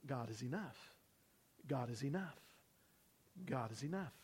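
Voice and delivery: inner thought voice. Shown as a demonstration. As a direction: say it like a thought in your head. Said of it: "I want you to be thinking".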